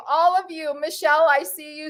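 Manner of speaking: ordinary talk, nothing marked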